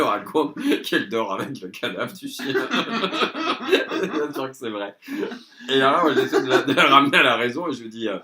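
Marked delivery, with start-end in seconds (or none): 2.6-4.1 shrieking giggle; high burst of laughter. Real, not acted. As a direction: let out a high laughing fit. Rit de l'histoire. (fou rire aigu)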